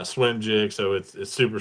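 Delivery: ordinary talk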